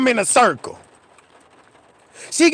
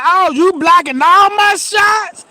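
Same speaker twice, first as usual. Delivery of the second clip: slow, high mock-outraged mama; mad mama; 6 semitones higher, 5 dB louder than usual. Mama mad her swings keep missing, mock-outraged and slow. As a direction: imitate his furious mama, drawn out. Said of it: "She was so mad".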